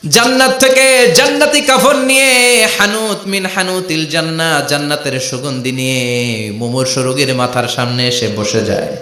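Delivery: normal talking voice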